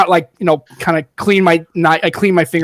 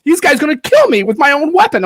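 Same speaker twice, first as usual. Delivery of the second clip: alarmed mugger; 8 semitones higher than usual. Retold or imitated.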